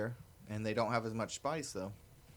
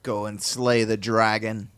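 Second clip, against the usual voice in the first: mock heroic; 2 semitones lower, 9 dB louder than usual.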